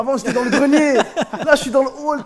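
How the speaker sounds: chuckling through the joke; laughing. Real, not acted. Joking stairwell metaphor.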